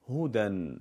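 normal talking voice

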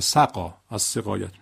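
ordinary talk, nothing marked

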